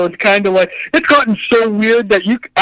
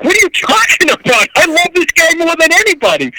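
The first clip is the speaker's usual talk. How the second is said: indignant protest; 8 semitones higher than usual. Retold or imitated. Friend asks why he treats him badly.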